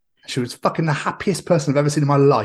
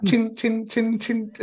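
high clinking noises, fading softer; mock clinking sound; 9 semitones higher, 3 dB quieter, much slower than usual. Joking about Santa, high fading clinks. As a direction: tap it out like bells, trailing off.

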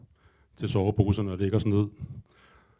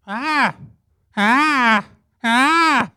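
much higher, louder bird screeches; the bird swooping in, screaming ah; bird shrieks closer; 13 semitones higher, 8 dB louder, much slower than usual. Retold or imitated. Bird closing in as he loses focus, much higher loud screeches. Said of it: "kom den tættere og tættere på".

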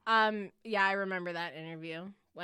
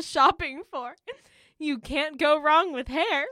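louder, lifted sales pitch; mock infomercial; 6 semitones higher, 9 dB louder than usual. Host pitching a silly new product, mock infomercial. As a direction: sell it like a TV advert, on the edge of laughter.

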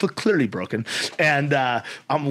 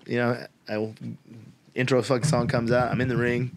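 ordinary delivery

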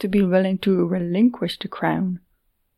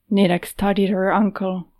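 ordinary delivery, nothing marked

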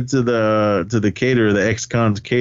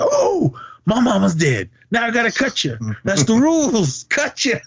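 high-pitched hammed-up outrage, breaking into laughter; mock threat; 10 semitones higher than usual. Retelling an insulted man's comeback about his mama, mock threat. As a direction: play the threat as a high comic wail.

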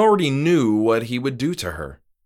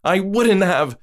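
put-on higher, louder young woman voice, firm; insisting; 10 semitones higher, 5 dB louder than usual. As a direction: push back hard, Moira insisting. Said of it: "she insisted".

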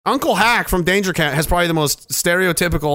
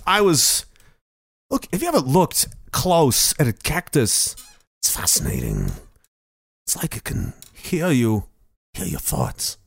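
spaced-out president; 2 semitones lower, 4 dB quieter than usual. Acted. President musing to aides about a cactus, dreamy and hushed. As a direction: drift off as if lost in a trance.

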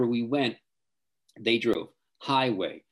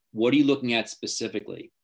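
ordinary delivery

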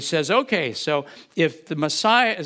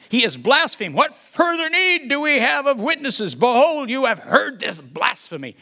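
higher, loud outraged cry; outraged high priest; 7 semitones higher, 3 dB louder than usual. Acted. Caiaphas before the council, higher outraged cry. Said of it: "you know he's yelling".

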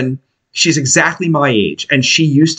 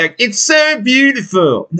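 mocking; 9 semitones higher, slower than usual. Mocking others' praise of Alaska.

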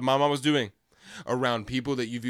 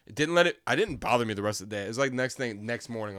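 ordinary talk

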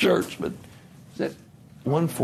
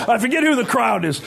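embarrassed laughter; 10 semitones higher than usual. Real, not acted. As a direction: burst of high laughter, then laughing words. Laughs at own slip-up.

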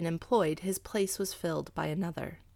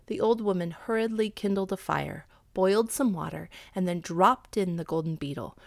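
ordinary delivery, nothing marked

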